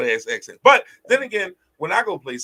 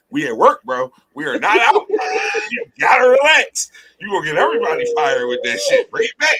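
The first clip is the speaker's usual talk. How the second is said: louder, higher laughing reprimand; mock scolding; 8 semitones higher, 4 dB louder than usual. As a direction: scold a friend at work, half joking. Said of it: "I used to have to tell her".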